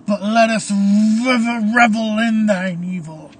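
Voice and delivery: loud, drawn-out evil parody of prayer; cutting in mockingly. A narrator's reading. Caleb cuts off the priest's prayer, loud mocking relish. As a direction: boom over the priest, relishing each word. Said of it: "Caleb cuts off again".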